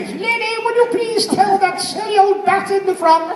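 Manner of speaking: a hint of the Queen on the phone; Queen impression. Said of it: "Yeah, she did. She rang me".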